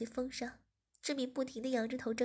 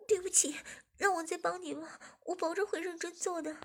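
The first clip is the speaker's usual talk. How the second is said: higher, hurried apology; apologetic woman; 5 semitones higher than usual. A narrator's reading. Zhiming begs the boss, higher voice. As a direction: beg for another chance.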